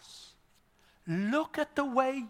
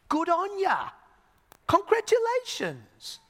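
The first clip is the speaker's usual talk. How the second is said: very high, exuberant cheer; big, drawn-out congratulating; hearty congratulations; 11 semitones higher than usual. Acted. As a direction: cheer them on, pitch soaring. Paraphrases blessing as hearty congratulations.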